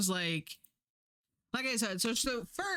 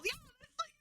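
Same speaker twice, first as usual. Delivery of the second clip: very high, softer, laughing squeal; 5 dB quieter, faster than usual. Real, not acted. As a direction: laugh through it in disbelief. Laughing rant at the character.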